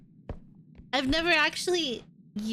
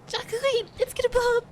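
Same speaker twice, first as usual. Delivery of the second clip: high, urgent warning; alarmed; 10 semitones higher, much faster than usual. Real, not acted. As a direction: yell a panicked warning. Warning friend of explosion.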